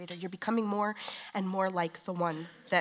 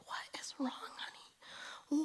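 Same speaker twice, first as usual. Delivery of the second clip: comforting mom; 9 semitones higher, 4 dB quieter, slower than usual. Retold or imitated. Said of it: "It's like".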